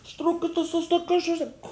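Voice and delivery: high, squeezed Ukrainian gibberish; mock shouting. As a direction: imitate someone yelling in Ukrainian. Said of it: "er schreit". (נאכמאכן א געשריי)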